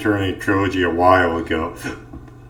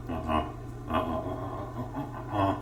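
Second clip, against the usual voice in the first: tense whispered uh-uh; 4 semitones lower, 14 dB quieter, much faster than usual. Real, not acted. Tense refusal while cornered.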